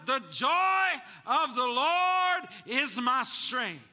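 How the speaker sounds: slow, loud, high proclamation; preaching shout. Real, not acted. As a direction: drag each word out and shout it to the back row. Preacher proclaiming scripture to congregation.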